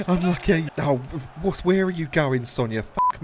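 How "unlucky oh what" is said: usual speaking voice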